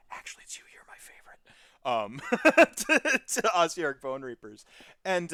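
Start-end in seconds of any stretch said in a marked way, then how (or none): 0.1-1.1 secret whispered confession; hushed aside to a favourite. Retold or imitated. Confiding to the other army.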